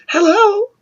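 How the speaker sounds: very high, sing-out mother's hello; imitated put-on telephone cheer; phony phone greeting. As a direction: switch to a sweet high phone voice. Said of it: "when she's yelling and screaming ... and the phone rings".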